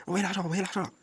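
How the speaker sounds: high-pitched mock begging; playful high demand; joking demand. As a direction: pitch it up and beg for money as a joke. Mock demand for money, then "je rigole.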